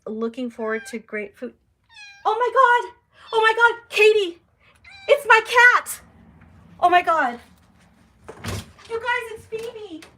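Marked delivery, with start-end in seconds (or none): 2.2-7.4 high, loud, stunned shrieks of joy; sudden joyful screaming at a lost cat; excited shriek. Real, not acted. Cat shows up at window. 8.9-10.0 high, slow and amazed; real overjoyed disbelief; breathless joy. Real, not acted. Cat shows up at window.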